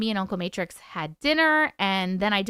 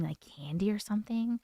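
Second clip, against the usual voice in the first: whispered, softer aside; hushed retelling; 2 semitones lower, 8 dB quieter than usual. Retold or imitated. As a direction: drop to a whisper mid-quote. Retelling partner's offer, hushed.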